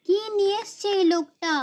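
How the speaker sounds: normal talking voice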